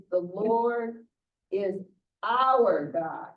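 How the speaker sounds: community voice leaning on "our"; stress on our. Shown as a demonstration. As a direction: land hard on "our" in "The Lord is our God". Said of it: "if I'm talking about my community".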